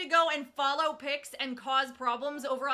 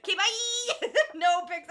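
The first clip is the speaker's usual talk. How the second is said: higher, speaking through giggles; laughing; 4 semitones higher, 6 dB louder than usual. Real, not acted. Streamer teasing a friend who pretends to leave, laughing. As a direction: say it while still cracking up.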